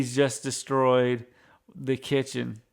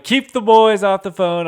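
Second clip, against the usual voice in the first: mock raised voice, higher and louder; retold mom telling the house to stay off the phone, raised voice; retold urgent call; 7 semitones higher, 10 dB louder than usual. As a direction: call it out like a mom shooing the kids. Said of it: "she's like".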